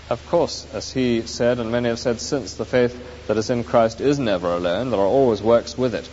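ordinary delivery

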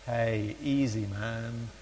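low, soft, slow and mellow; laid-back seventies dude. Retold or imitated. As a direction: imitate a chilled-out seventies gang member. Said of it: "'cause it's the seventies".

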